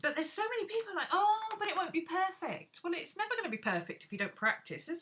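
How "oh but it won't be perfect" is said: high, fast mocking whine. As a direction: mimic a fussy perfectionist's complaint.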